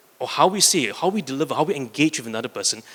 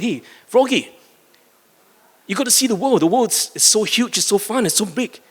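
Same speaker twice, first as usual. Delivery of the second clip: higher, bouncy little bunny voice urging the frog; eager bunny; 8 semitones higher than usual. Retold or imitated. Bunny calls down the well to the frog, high and eager. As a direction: pitch up and coax like an excited bunny. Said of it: "the bunny hopped over to the frog well and said".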